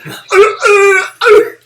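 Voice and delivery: high loud crying wail; mock wail of the hurt woman; grape lady wail. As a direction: imitate her pained wail. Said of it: "And she said".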